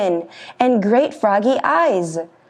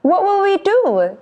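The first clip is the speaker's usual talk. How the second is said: high goat voice; 11 semitones higher than usual. Acted. Middle goat voiced in story.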